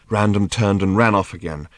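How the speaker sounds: usual speaking voice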